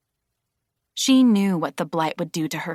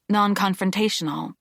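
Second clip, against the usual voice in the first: ordinary delivery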